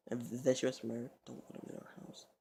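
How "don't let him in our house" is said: hushed, voiceless aside; whisper. Retold or imitated. She warns against letting him in, whispering. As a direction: whisper it as a secret warning. Quoting her aside about the house.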